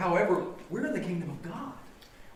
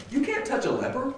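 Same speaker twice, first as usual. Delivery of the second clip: ordinary talk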